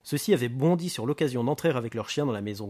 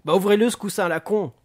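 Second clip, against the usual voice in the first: loud, high, angry order; furious impatience; exasperated; 8 semitones higher, 9 dB louder than usual. Acted. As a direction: snap at the bomb expert to get on with it. Booker snaps at bomb expert.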